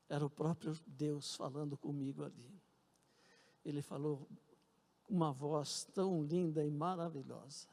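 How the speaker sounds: normal talking voice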